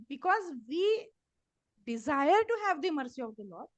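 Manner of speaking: ordinary talk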